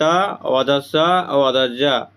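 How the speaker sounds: आधा ज spelled out very slowly. Shown as a demonstration. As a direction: drag each syllable of आधा ज. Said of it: "आधा त". (बहुत धीरे, अक्षर खींचकर)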